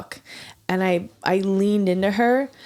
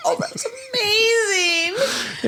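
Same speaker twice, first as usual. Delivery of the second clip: high slow laughing cry; delighted laughing shout; 13 semitones higher, much slower than usual. Real, not acted. Friend reacting to the bully story, laughing delight. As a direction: gush with laughter.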